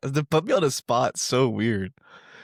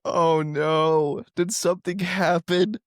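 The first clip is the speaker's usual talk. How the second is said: mock worry; 7 semitones higher, 3 dB louder, much slower than usual. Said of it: "I'm like".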